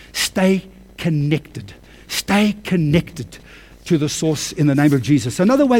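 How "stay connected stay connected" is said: slow emphasis. Shown as a demonstration. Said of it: "the Lord told me".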